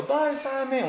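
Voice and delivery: put-on very high little child voice; kids saying bye. Kids waving goodbye to Simon, very high. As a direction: chirp goodbye like a small kid. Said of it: "So then go".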